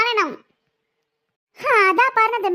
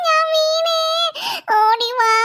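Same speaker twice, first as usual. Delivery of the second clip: crying call; 5 semitones higher, much slower than usual. Acted. Fallen child calls mother.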